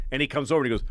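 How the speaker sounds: ordinary talk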